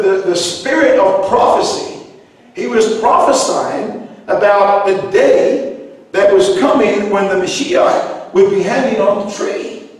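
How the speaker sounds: ordinary talk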